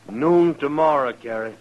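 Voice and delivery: loud call after her; calling to Carrie as she goes. Acted. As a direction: call it across the room.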